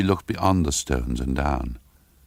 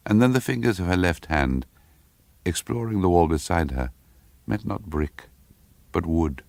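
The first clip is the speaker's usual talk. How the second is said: ordinary delivery, nothing marked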